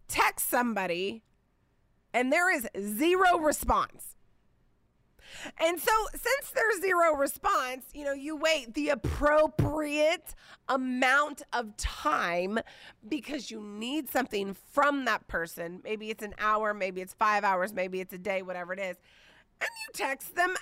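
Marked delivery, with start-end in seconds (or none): none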